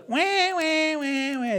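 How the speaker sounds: high imitated whining that drops off; mock baby wail. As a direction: mimic grown-ups wailing like babies. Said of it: "they're baby Christians".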